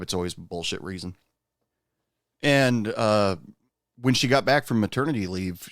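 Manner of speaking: ordinary delivery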